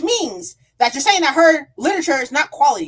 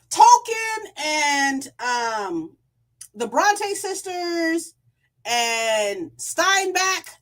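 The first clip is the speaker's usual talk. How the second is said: ordinary talk, nothing marked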